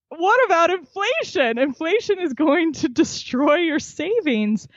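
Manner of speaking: mock a dad who can't believe she missed it; exasperated dad.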